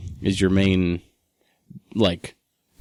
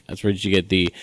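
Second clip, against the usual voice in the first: ordinary delivery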